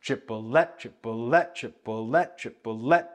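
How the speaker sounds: triplet with a lifted, rising third syllable; stress on the last syllable. Shown as a demonstration. Teacher demonstrating to students, triplet with the last syllable lifted and stressed. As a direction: let the -let of triplet lift upward. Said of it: "You get that sense of upward movement, lift".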